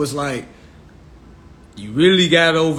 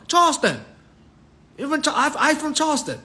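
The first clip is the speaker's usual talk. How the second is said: high-pitched imitated Charleston accent; Geechee accent; 12 semitones higher, faster than usual. Charleston teammate answering where his people are from, Geechee accent. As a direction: mimic a Geechee speaker, pitched way up. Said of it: "it's that Geechee accent".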